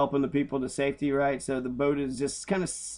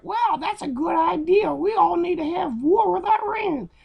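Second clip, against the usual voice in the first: much higher, dopey, gullible voice; mocking the crowd; 4 dB louder than usual. Mocking gullible Americans cheering for war, high dopey voice. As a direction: play the eager sheep who nods along. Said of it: "people are gonna be like".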